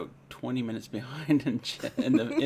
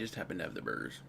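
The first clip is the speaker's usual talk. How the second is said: whisper; 9 semitones lower, 8 dB quieter than usual. Real, not acted. Whispered aside while cooking show.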